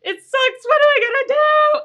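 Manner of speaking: high, loud, panicky wail, close to tears; hammed-up despair, shrill and rising; mock panicked wail. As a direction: play the crushed writer melting down.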